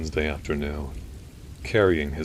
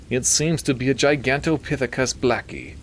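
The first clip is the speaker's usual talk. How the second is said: high nasally would-be British expert; identify it through the nose, pitched up; Milton; 8 semitones higher, 4 dB louder than usual. A narrator's reading. Doctor naming the creature for the priest, nasally. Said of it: "He had a nasally voice".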